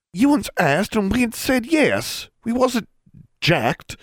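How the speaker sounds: put-on high-pitched, indignant girl's voice; offended. A narrator's reading. Constance objects to being called jacked, offended. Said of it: "Constance sounded even more offended".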